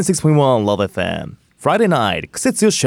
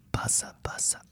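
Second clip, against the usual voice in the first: whispered fan flaps; 17 dB quieter than usual. Acted. Fanning sound effect in skit.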